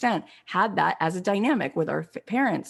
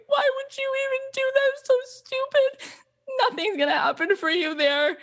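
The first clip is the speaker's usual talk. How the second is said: imitated bawling; 12 semitones higher than usual. Mother bawling at her daughter's L A dream, imitated wail. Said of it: "And she was bawling".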